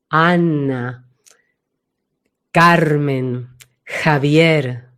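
ANA, CARMEN, JAVIER low, from the back; each name deep and separate, placed behind; deep resonance. Shown as a demonstration. Voice coach lets listeners feel the names' depth, low. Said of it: "Tienen mucha profundidad".

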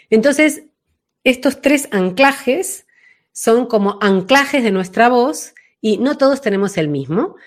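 ordinary delivery

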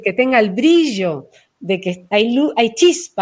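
normal talking voice

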